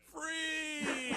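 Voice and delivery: high, sustained singsong cry; long, held high note; mock giveaway announcement. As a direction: sing it out high like a taunting announcement. Mimics store's giveaway announcement.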